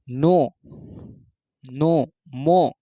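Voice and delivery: नो मो held very slowly through the nose; nasal demo. Shown as a demonstration. Teacher slowly demonstrates nasal नो मो. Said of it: "आप बोलो अंगों".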